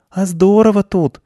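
put-on high little girl's exclamation; delighted girl. A narrator's reading. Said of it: "сказала Аня, вдыхая густой сенной запах".

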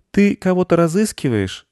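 put-on high boy's question; high-pitched young boy asking gently; little boy. A narrator's reading. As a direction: ask in a high child voice. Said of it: "спросил мальчик".

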